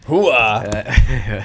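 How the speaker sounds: loud gung-ho soldier shout; Al Pacino impression. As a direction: bark it like a hyped Pacino soldier. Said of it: "do the Al Pacino impression".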